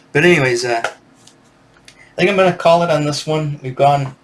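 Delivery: ordinary talk, nothing marked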